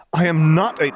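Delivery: put-on offended teen protest; indignant outburst. A narrator's reading. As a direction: flare up in a royal snit. Jaina starts indignant snit.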